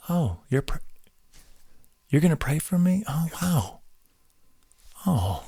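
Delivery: soft, breathless little oh of being moved; touched, hushed awe. Retold or imitated. Someone promised prayer, answering in a soft, stunned hush. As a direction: let the words fall away, quietly touched.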